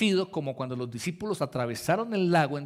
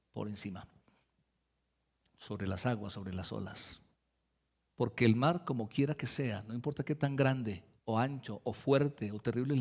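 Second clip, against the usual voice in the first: soft reassurance; 7 semitones lower, 7 dB quieter than usual. Acted. Preacher comforting the congregation, low soft reassuring voice. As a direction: settle them gently into trust.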